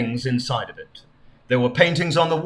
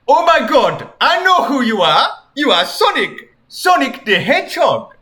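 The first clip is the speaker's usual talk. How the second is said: mock amazed king; 8 semitones higher, 4 dB louder than usual. King Tunt recognizes Sonic.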